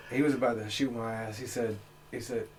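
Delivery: normal talking voice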